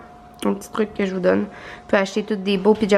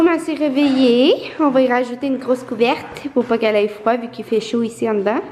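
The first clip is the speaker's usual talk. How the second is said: ordinary talk, nothing marked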